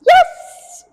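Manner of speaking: very high, loud yes; high pitched marker yes. Shown as a demonstration. Trainer showing viewers the marker word, high pitched. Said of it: "should be nice and high pitched".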